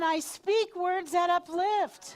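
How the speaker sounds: slow, high, loud declaration; energized affirmation. Shown as a demonstration. Leader urging a standing group to echo, energized affirmation. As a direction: proclaim it slowly with full energy. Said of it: "Put your energy into this".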